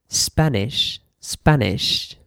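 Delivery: "Spanish" ending in a short ish; "Spanish" said as a teacher's example with ish at the end. Shown as a demonstration. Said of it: "So the sound, the pronunciation is".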